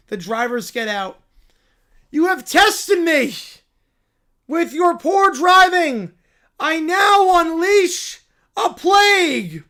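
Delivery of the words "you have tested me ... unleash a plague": high, loud, slow cartoon bear voice; a cub thrilled by the news; excited little bear. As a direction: squeal it like an overjoyed cub. Bear proclaiming slowly.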